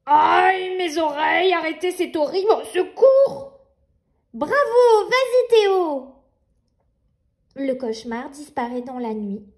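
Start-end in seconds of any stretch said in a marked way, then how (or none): 0.0-3.6 panicked cry. Acted. Nightmare cries for help. 4.3-6.2 cheering. Acted. Nina cheering brother.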